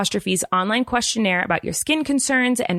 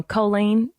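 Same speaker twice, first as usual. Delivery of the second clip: Kansas City drawl. Shown as a demonstration. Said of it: "you've just kept the accent".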